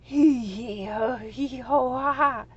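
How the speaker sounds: loud booming hee hee ho ha; roaring dragon laughter. A narrator's reading. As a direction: roar with helpless laughter. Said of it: "he roared".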